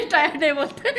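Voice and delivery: giggling self-correction; laughing at own slip. Real, not acted. Teacher laughing at her own slip in class. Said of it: "sorry, sorry".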